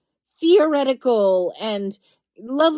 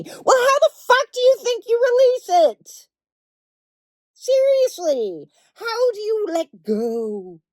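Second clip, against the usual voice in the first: exasperated outburst; 8 semitones higher than usual. Real, not acted.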